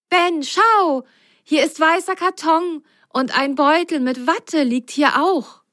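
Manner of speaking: high, bright and happy; delighted discovery. Acted. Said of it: "freute sich Biene".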